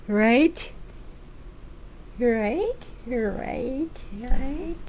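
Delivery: high cooing right at the cat; high coaxing. Real, not acted. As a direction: coax the cat up in pitch.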